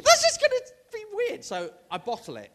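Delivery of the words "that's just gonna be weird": much higher, louder, fast fretting; high, quick, anxious mock whine; panicked inner voice. Repeats his panicked thought.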